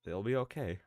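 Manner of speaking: tender letter reading. Acted. Streamer reads a dying mother's letters to her family, tender. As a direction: softly read farewell letter, hushed in places.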